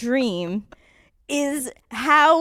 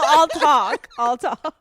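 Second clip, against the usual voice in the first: louder, higher, mock take-charge; mock confident; 3 semitones higher, 4 dB louder, slower than usual. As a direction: play the brave one, half laughing. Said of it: "I go, I go".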